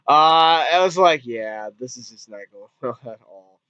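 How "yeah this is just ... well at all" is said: low, much softer aside to oneself; unimpressed aside. Retold or imitated. Viewer commenting to herself on a failing movie, unimpressed. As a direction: drop low and quiet, resigned. Reacting aloud to a bad scene.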